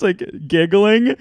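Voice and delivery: usual speaking voice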